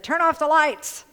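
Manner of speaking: high, loud parrot voice; parrot command. Retold or imitated. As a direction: squawk the order like a bird. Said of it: "the parrot said".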